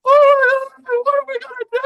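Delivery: high-pitched, loud exaggerated wail; mock crying. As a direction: imitate a partner wailing in a panic. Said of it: "like, crying".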